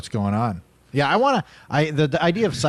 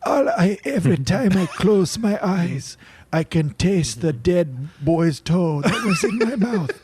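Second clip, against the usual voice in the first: melodramatic victim voice; 6 semitones higher than usual. Acted.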